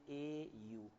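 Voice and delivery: "एयू" slow and low, sound by sound; "एयू" as two slow separate sounds; spelt-out sounds. Shown as a demonstration. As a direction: draw out the two parts of "एयू". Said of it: "इसको बोलना क्या चाहिए".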